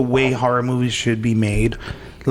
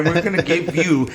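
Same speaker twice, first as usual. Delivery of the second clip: ordinary delivery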